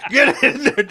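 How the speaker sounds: high, loud forceful command. Retold or imitated. Man in the film ordering someone into the house, forceful. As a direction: shove the words out with force. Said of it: "like, with force".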